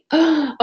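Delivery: sharp gasp. Real, not acted. Realizes wrong paragraph.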